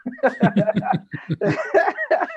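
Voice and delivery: high, bursting laugh at himself; a real amused laugh. Real, not acted. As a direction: laugh at how naive that sounds. Laughing at his old dream.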